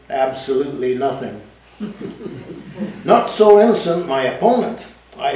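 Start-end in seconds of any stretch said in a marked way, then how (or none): 1.8-3.0 soft breathy laugh. Real, not acted. Narrator laughs at own story.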